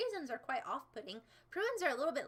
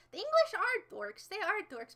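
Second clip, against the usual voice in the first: mock loud jab; 7 semitones higher, 5 dB louder than usual. Teasing chat about the English.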